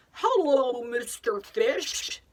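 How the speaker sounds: put-on drawn-out, cheery call to Mister Fish, as if to a child; playful greeting to a fish. Shown as a demonstration. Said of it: "So I'll say something like".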